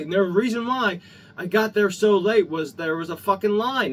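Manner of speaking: usual speaking voice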